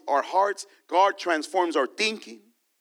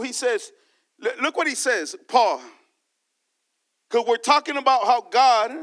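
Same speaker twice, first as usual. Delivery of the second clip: impassioned preacher calling out; preaching; 4 semitones higher, 3 dB louder than usual. Acted. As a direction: preach it to the church with fire. Preacher addressing congregation.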